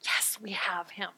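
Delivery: secret whispered triumph; gleeful whisper. Retold or imitated. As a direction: hiss it under your breath like plotters gloating. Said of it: "tearing the clothes and going".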